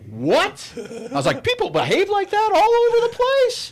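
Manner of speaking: high-pitched mock astonishment; hammed-up shock, voice shooting way up; incredulous outburst. Acts out disbelief at affectionate family.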